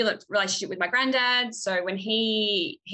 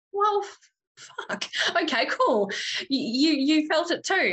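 startled realization; 8 semitones higher than usual. Retold or imitated. Shocked at cousin's confirmation.

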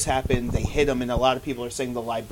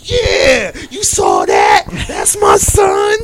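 loud, hammed-up triumphant yell; whooping acted celebration, stretched at the end; proud cheering shout; 12 semitones higher, 12 dB louder, much slower than usual. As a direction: shout it like a thrilled, boastful dad. Acting out proud father cheering.

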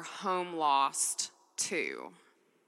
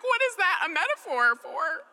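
much higher, put-on bewildered voice; mock bafflement; 11 semitones higher than usual. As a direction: ask it in hammed-up confusion. Quoting own waking confusion.

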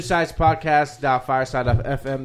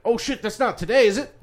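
high-pitched retold jolt of realisation; mock alarm; 8 semitones higher than usual. Friend retelling how he found the date on his calendar, mock alarm. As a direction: act out suddenly remembering, half laughing.

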